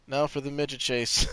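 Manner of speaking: ordinary talk, nothing marked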